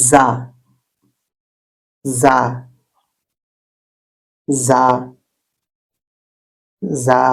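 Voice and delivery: জা repeated a bit harder; firm za. Shown as a demonstration. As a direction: buzz the জা firmly each time. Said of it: "এটা একটু শক্ত হবে". (শক্ত করে জা)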